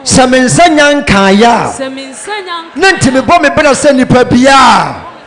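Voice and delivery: loud, full-force call to prayer; big, insistent preacher's prayer voice; fervent prayer leading. Real, not acted. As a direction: drive the congregation into prayer at full volume. Leads congregation into loud prayer.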